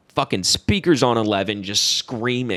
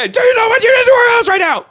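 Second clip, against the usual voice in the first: high, loud, fast shouting; mock enraged screaming; 8 dB louder, faster than usual. Imitating dad asking what was done to our house, enraged screaming. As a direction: scream it in a rush.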